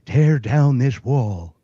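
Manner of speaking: slow, drawn-out presidential voice; slow it down like an old president's speech; Reagan impression. Showing off impressions, he does a slow Reagan line. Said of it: "I can do more of a Reagan".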